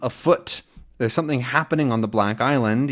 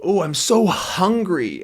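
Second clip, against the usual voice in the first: mock hungry moan; 6 semitones higher than usual. Shown as a demonstration. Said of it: "Starving is when".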